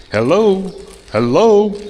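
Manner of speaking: calling out high and loud to hear the reverb; testing the echo. Real, not acted. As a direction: call out to test the echo. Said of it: "there's a bit of a reverb here".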